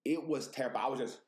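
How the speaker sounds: ordinary talk, nothing marked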